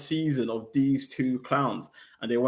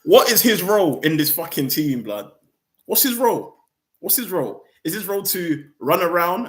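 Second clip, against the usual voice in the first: louder and higher, demanding an answer; furious rant; 4 semitones higher, 5 dB louder than usual. Real, not acted. Angry fan asking the club about the player's role, loud. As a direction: bark the question at the club.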